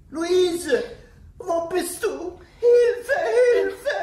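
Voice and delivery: high, loud, half-laughing cry for help; hysterical, cackling cry for help; shouting for help. Acted. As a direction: scream for your daughter. Old woman calling for help.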